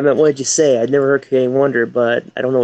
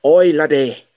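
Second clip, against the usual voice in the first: mock Scottish; 3 semitones higher, slower than usual. Joins in the Scottish joke.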